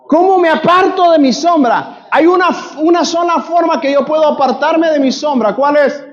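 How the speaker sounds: impassioned sermon, rising to shouts; preaching. Acted. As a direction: preach it hard to the congregation. Preacher teaching with call and response. (predicando con fervor, a gritos)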